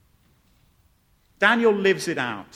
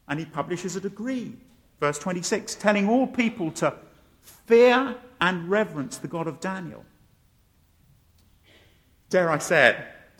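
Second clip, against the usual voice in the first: ordinary talk, nothing marked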